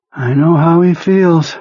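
high, slow, drained voice of a sick man; sick psychic Perlmutter. Acted. Ill telepath reporting to his boss, high and drained. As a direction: sound weak and washed out.

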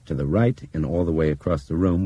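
ordinary delivery

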